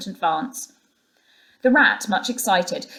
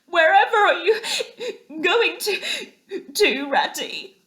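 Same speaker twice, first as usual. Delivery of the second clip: high, quavering, near-sobbing cry; a frightened little animal crying out; tearful cry; 12 semitones higher, 3 dB louder, slower than usual. A narrator's reading. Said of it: "cried the tearful Mole".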